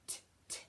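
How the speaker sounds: "t, t" as two short voiceless tapped t sounds, no vowel; voiceless tonguing t. Shown as a demonstration. Teacher showing students the tonguing sound, "t, t" as soft voiceless taps. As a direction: whisper "t, t" as crisp little tongue taps. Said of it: "We were using the little t".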